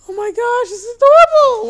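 very high, loud gushing squeal; shrill-free but very high cooing burst; squealing delight. Real, not acted. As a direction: squeal over something cute. Babies appear.

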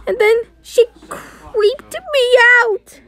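slow, high fake sob through the words; a high, slowed play sob; pretend crying. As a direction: cry it out like a hurt toy. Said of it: "[name], why are you crying".

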